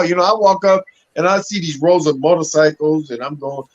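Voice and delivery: ordinary talk, nothing marked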